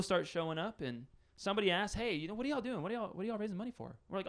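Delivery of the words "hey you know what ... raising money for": higher-pitched, casual questioner; friendly inquiry in a raised pitch; curious passerby. Acted. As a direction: lightly voice a nosy bake-sale customer, pitched up. Voicing a curious bake sale customer.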